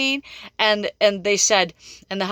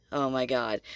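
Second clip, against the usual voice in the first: much lower and softer, breathed out in disbelief; quiet awe; 8 semitones lower, 8 dB quieter than usual. Retold or imitated. Reader stunned by thirteen cards, quiet awe. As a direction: let it fall away, stunned. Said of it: "I'm like".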